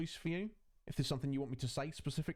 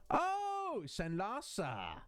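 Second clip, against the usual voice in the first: excited greeting; 9 semitones higher, much slower than usual. Real, not acted. Greeting a viewer in chat.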